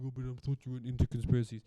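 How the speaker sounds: quick put-on voice of a sceptic objecting; mock objector. Imagined listener objects to the host's claim, mocking voice. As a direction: imitate a doubting listener, rattled off fast. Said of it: "you're gonna be like".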